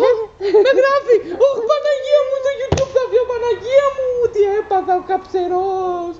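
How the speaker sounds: high, loud mock wailing to the saints; hammed-up panic. Friend films him as he wails in mock despair. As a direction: wail it like a melodramatic old auntie.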